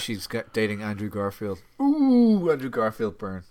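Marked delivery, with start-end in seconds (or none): 1.8-2.5 loud, high mocking ooh; high loud taunting ooh; high teasing ooh. Real, not acted. Teasing burn exclamation.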